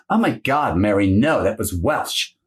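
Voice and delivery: normal talking voice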